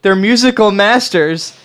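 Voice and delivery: loud, high-pitched impassioned defense; indignant outburst. Acted. Band fan rebuking a co-host, indignant and loud.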